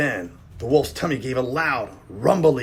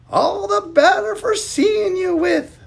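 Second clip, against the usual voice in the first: high, slow quavery granny voice; wobbly voice; 6 dB louder than usual. Acted. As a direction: put on a wobbly old lady voice. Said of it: "in that same wobbly voice".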